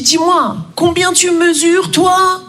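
menacing question. Acted. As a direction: size someone up suspiciously, drawing it out. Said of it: "C'est une menace ou une question?".

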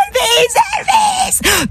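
very high, long-drawn wailing cry; screaming fans. Acted. People at the limousine door, high mock scream. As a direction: imitate hysterical fans, stretched out. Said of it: "Les gens à la porte de la limousine".

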